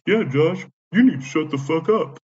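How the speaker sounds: mimicked scolding woman, high and loud; Yvonne. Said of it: "And she's like".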